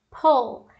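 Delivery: "pull" with a rounded OO, falling; contrasted vowel. Shown as a demonstration. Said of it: "A vogal é diferente". ("pull" com vogal arredondada, PUL)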